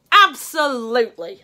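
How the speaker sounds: put-on loud, high smug child's voice; taunting little brother. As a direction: needle big brother on purpose.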